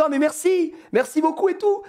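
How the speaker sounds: high, gushing thanks; imitated overcome gratitude. Moved mother thanking him for a photo, imitated high gushing voice. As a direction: mimic someone deeply moved. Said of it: "elle était trop émue".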